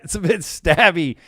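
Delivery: loud, high, exaggerated English accent; horrific English accent. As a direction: ham up a bad English accent. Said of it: "that's my horrific English accent".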